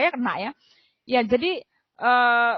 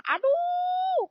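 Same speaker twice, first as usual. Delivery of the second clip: high pained exclamation; 3 dB quieter than usual. Retold or imitated. Dizzy, seeing stars.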